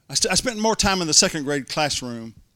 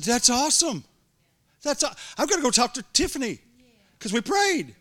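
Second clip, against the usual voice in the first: much higher and louder, bursting with joy; overjoyed; 10 semitones higher, 4 dB louder than usual. Retold or imitated. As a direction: burst out happy at the answered prayer. Excited at the good news.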